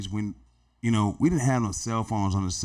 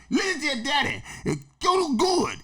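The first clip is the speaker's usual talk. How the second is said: much higher, tender blessing. Retold or imitated. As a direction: bless the boy head to head. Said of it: "put his head to my head and said".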